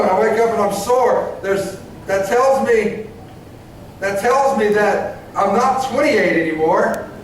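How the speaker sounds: ordinary delivery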